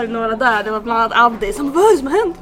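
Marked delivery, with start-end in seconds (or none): 1.8-2.4 loud, high excited question; re-enacted shout of alarmed curiosity; imitated shouting. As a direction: cry out as the startled onlooker. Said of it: "Så alla började skrika så här".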